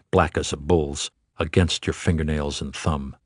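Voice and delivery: usual speaking voice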